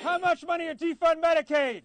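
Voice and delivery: shouted call for a crowd's response; rally shout. Real, not acted. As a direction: fire the question out to rouse a crowd.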